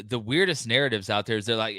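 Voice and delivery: normal talking voice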